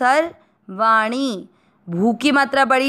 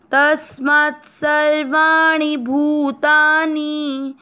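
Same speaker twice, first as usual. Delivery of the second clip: slow, raised, chanted Sanskrit verse; shloka recitation; 4 semitones higher, slower than usual. Shown as a demonstration. Teacher recites the Gita verse for learners, slow chant. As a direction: intone the verse slowly and clearly.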